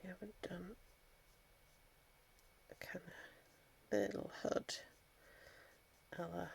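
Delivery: very soft, near-whispered thinking aloud; absorbed murmur. Real, not acted. Crafter painting a little bird, absorbed murmur. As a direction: murmur it to yourself, attention on the brush.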